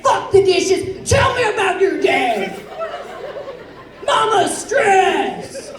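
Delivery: exaggerated stressed-out mama. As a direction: play the breadwinner mom who storms in demanding a massage. Stressed mama coming home.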